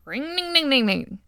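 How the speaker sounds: high whirring buzz sliding down; imitated carving noise. Teller mimics aunt carving the turkey, buzzing sound effect. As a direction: ring out a high mechanical whine.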